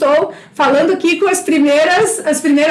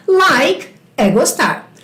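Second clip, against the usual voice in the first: slow teaching; slower than usual. Shown as a demonstration. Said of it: "teacher demo".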